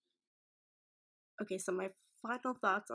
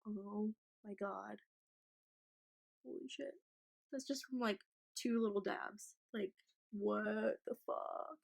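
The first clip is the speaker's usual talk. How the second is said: stunned and hushed; 2 semitones higher, 4 dB quieter than usual. Real, not acted. Stunned by the pigment.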